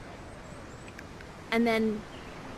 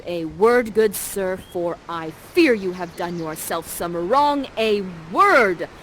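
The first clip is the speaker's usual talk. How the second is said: louder, commanding protective mother; stern Prospera; 3 semitones lower, 7 dB louder, slower than usual. Acted. Prospera pointing her staff at Ferdinand, louder and stern. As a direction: warn him off like an angry mama bear.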